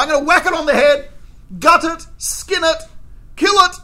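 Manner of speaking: high and loud, slowing on gut it, skin it; waiter's rising threat to the diner; building angry rant. Retold or imitated. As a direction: hammer each gory verb home.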